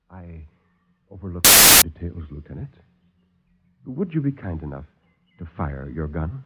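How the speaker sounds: calm the visitors like a silky host; suave doctor. Acted.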